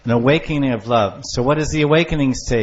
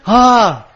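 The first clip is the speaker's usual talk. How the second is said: joyful cry; 7 semitones higher, 6 dB louder than usual. Acted. Lover meeting beloved, acted.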